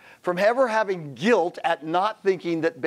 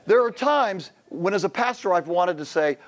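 usual speaking voice

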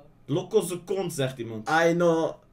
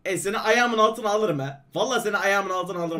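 fast rattle; 2 semitones lower, faster than usual. Pretend. Rattles off a rhyme.

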